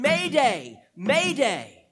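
loud urgent cry of Mayday twice; calling mayday. Retold or imitated. Preacher voicing David's cry to God, loud urgent call. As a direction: shout it like a distress call from a sinking ship. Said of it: "David is saying".

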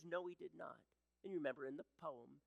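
usual speaking voice

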